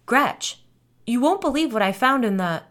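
high, loud rush of news; breaking big news to a friend; excited blurting. A narrator's reading. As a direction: burst in bursting to tell, then cut off. Bursts in with news, cut off.

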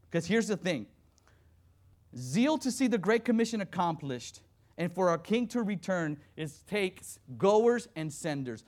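loud, rising preacher's call; fervent preaching. Acted. Preacher urging the congregation, full-force sermon. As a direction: preach it out with fire to the church.

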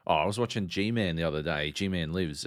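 usual speaking voice

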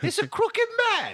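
high, sing-song mock English accent; high mock English accent, shrugging. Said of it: "Things are different in England".

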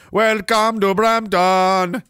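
high, loud, stretched-out greeting; mock welcome. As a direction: call it out slowly like a put-on welcome.

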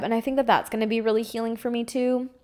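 ordinary delivery

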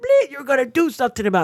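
high, quick mock scolding; mad veteran. Angry caller telling the host to act, mimicked high. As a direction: fire it back at him like the angry caller. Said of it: "And he was mad".

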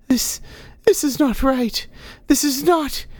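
very high, distressed protest; alarmed old woman. Acted. Poisoned old woman realising something is wrong, high and alarmed. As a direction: break off in rising panic.

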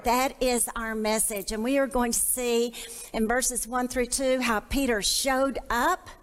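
ordinary talk

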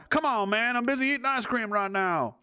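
high-pitched mimicry of an annoyed leader; mock whiny brush-off. Mocking leader busy eating ice cream, whiny brush-off.

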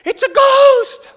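very high, loud, slow shriek of fear; terrified shriek. Retold or imitated. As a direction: scream like you've seen a ghost. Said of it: "Peter's like".

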